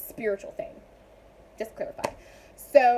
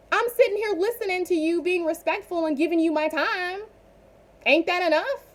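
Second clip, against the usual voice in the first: higher-pitched, exasperated protest; indignant rant; 7 semitones higher than usual. Acted. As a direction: argue back at critics, pitched up. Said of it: "Sorry for a little rant".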